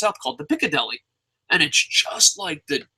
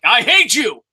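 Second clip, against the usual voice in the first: mock yell; 5 semitones higher, 5 dB louder than usual. Yelling at baby at birth.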